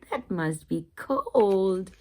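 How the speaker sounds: usual speaking voice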